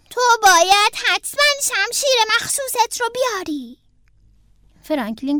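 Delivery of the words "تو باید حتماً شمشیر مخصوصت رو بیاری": put-on high, loud child-character voice; insistent young bear. A narrator's reading. Bear tells Franklin to bring his special sword, insistent. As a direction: press the friend to promise.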